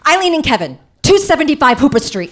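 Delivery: a freaked-out aunt bellowing the address; panicked yelling. Retold or imitated. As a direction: yell the address out loud, frantic. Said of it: "all she can do is yell out our addresses".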